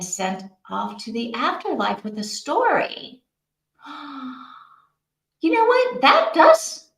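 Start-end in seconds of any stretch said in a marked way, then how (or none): 3.9-4.4 gasp. Real, not acted. Reacting to book description. 5.4-6.9 sudden burst of delight at the idea; excited. Real, not acted. Excited by book premise.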